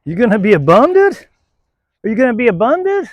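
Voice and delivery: high, loud playful teasing; mock-scolding a lazy dog in a high voice. Real, not acted. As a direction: tease her for slacking.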